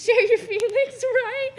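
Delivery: laughing through the words, high and loud; giggling while saying goodbye. Real, not acted. Teary graduate laughing to her sister.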